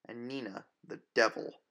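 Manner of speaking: plain reading voice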